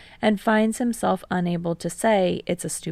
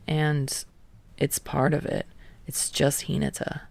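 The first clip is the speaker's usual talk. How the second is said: low, halting, half-whispered; halting mutter; 4 semitones lower, 6 dB quieter than usual. Acted. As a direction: drag each bit out reluctantly. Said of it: "Kei mutters haltingly".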